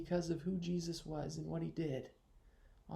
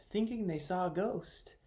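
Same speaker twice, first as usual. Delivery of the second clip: ordinary talk, nothing marked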